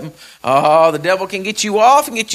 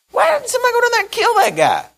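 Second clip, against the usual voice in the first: put-on high, indignant young shepherd; David's indignant voice. David asks why nobody killed the giant, high and indignant. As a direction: pitch way up, outraged at the taunt. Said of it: "He says".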